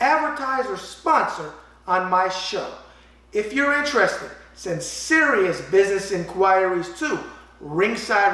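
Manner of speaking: loud, punchy pitchman read. Acted. Host pitching advertisers on his show, as a brash ad read. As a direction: sell it like a brash New York radio spot. Reads his own sponsor ad.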